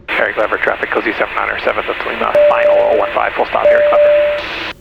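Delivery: low, level radio-call voice; pilot radio call. Real, not acted. Pilot reporting inbound to Harry Clever traffic, low radio-call voice. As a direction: announce your position in a low pilot's monotone.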